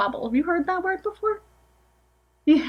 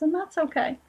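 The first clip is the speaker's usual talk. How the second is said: usual speaking voice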